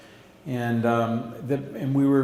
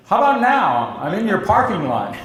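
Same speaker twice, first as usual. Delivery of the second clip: punchline; 6 semitones higher, 5 dB louder than usual. Retold or imitated. Retold surprise visit, punchline.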